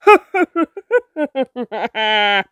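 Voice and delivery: loud, ugly witch cackle; loud disgusting cackle. Acted. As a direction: cackle like a gloating hag. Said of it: "the witch laughed so loud and so disgustingly".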